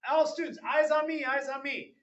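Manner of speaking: high, singsong attention call; high sing-song call. Shown as a demonstration. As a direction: call the class with a lilting voice. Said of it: "I'll be like".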